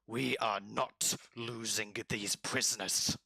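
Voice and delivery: slow, rasping lizard creature; firm lizard. Acted. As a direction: draw out each word as a rough lizard. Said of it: "the lizard goes".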